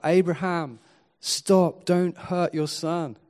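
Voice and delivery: angel's shout. Retold or imitated. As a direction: call out to stop the father. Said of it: "when the angel shouted out".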